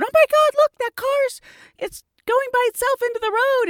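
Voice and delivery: high, mock-alarmed bystander cry; bystanders' alarm. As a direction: imitate strangers pointing at the car. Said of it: "when people are going".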